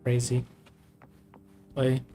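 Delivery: normal talking voice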